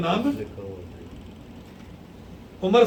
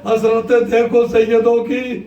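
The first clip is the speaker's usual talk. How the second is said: rising to a loud, grieving cry to the gathering; lamenting preaching; 3 semitones higher, 5 dB louder than usual. Acted. Preacher cries out to the mourners, louder lamenting. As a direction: mourn it aloud as the sermon swells.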